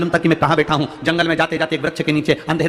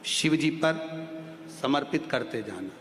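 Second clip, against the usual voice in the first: soft, slow, measured teaching; hushed, unhurried preacher; calm devotional instruction; 2 semitones lower, 12 dB quieter, slower than usual. Real, not acted. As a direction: give ritual instructions quietly, with pauses between phrases.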